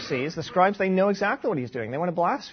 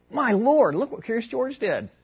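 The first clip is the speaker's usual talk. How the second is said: high-pitched imitation of an amazed son; surprised child; 7 semitones higher than usual. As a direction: exclaim like a kid amazed by TV. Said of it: "he's surprised by it and he was going".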